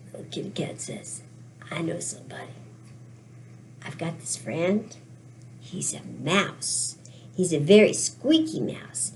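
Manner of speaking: low, soft, halting; hushed confiding. Acted. Little kitty cat telling about a mouse friend, low and hushed. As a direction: confide it quietly, voice dropped low.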